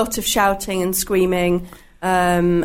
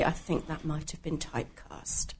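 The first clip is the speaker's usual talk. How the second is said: hushed quoted whisper; whispered aside; 3 semitones lower, 14 dB quieter than usual. Retold or imitated. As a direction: whisper it as a sly joke.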